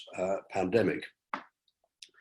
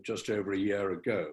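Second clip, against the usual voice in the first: normal talking voice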